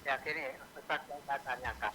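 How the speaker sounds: faint distant phone voice; far from mic. Real, not acted. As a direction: talk far away from the microphone. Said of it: "bisa mendekatkan, eh, mikrofonnya".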